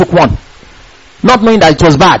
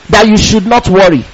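usual speaking voice

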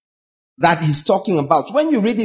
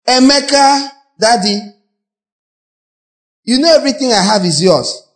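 higher, doting father voice; indulgent father; 5 semitones higher than usual. Retold or imitated. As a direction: play a father spoiling his son.

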